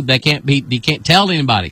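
ordinary talk

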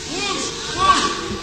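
breathy rushing whoosh like wind. Shown as a demonstration. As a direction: blow it out like a gust. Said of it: "The wind over here".

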